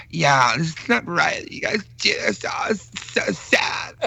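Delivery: high, soft, slow caricature; wordy whine that thins out, mocking; mock women chatting. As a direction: imitate wife and friend in drawn-out high voices. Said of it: "we would imitate my wife and her friend talking".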